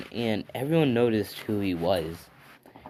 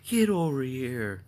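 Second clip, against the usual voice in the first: drawn-out, higher call to a dog; 4 semitones higher, slower than usual. Real, not acted. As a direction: stretch each word out, calling the dog over. Calling the dog, repeated drawn out.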